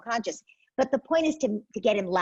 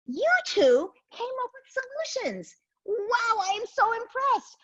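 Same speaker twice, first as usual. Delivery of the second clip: high, bright over-the-top praise; praising parent. Acted. Parent cheering both children for finding a solution, high and excited. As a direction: gush over the kids like a proud cheerleader.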